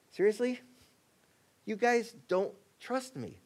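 Jesus asking disciples, incredulous; exasperated. Acted. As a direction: let disbelief color it.

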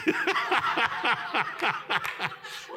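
long, high run of laughter; drawn-out amused cackling; hearty laugh. Real, not acted. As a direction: laugh helplessly at your own joke. Laughs at his own joke.

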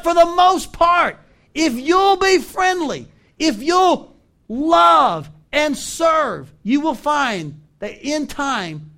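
high, loud, slow preaching with weighty beats; full-force preaching. Real, not acted. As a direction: proclaim it to the whole church, word by word. Preacher builds to his point.

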